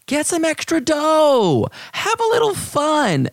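high, sing-song ad voice; high playful ad read. Acted. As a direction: sell it in a bright, high voice.

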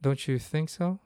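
ordinary talk, nothing marked

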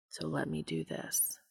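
low, soft whisper. A narrator's reading. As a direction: breathe it out quietly. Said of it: "the angel whispered quietly".

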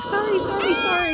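very high, flustered sorrys; panicked owner apologizing; high-pitched mock panic; frantic apology. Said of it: "I'm like".

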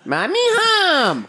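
high, slow sing-song call. Real, not acted. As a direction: sing it out to someone at the door. Greeting wife arriving home.

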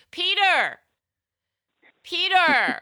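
high, loud call of a name; mock yell for a friend. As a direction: shout the name across the room. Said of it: "walks in and is like".

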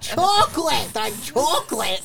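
a hint of a cartoon deaf lady; imitating the SpongeBob lady. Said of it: "She's like".

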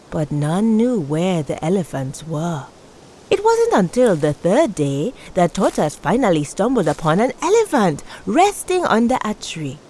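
plain reading voice

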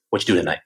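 "What you doing tonight" run into one quick phrase; casual "What you doing tonight" with syllables cut off; words flowed together. Shown as a demonstration. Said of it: "We flow the words together".

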